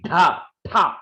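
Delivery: Dha, tha hit firmly; emphasized aspirates. Shown as a demonstration. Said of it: "I always emphasize".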